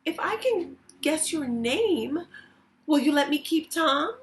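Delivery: a hint of a hopeful young girl asking; Lily's voice. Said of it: "she said".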